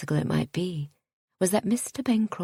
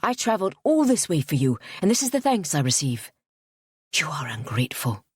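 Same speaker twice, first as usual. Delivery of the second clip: indignant; 3 dB louder than usual. A narrator's reading. Suitor's indignant outburst.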